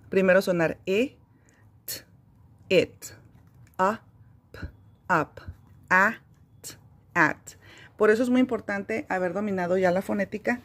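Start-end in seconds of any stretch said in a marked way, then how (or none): 0.1-7.4 phonics sounds separately, then blended; sounding out letters. Shown as a demonstration. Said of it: "Por ejemplo, aquí".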